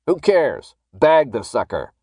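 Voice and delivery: loud, dismissive masked guard; callous order. A narrator's reading. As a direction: brush him off and order him taken. Guard callously orders man bagged.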